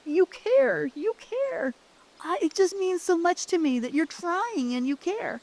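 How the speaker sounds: high, crying plea with a breaking voice; choked-up repeating of thanks; tearful high voice. Retold or imitated. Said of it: "He's got tears going down his cheeks".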